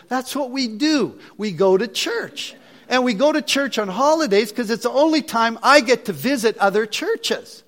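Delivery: mock-outraged father, pitched high and forceful; hammed-up exasperated parental rant; indignant dad. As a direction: scold your kid in disbelief, drawing out key words. Acts out indignant father's scolding reply.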